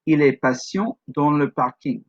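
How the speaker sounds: "Il est patient" rising, then "dans le parking" falling; French sentence, up at "patient", down to "parking"; two-part intonation. Shown as a demonstration. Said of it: "Sometimes they have more parts to them".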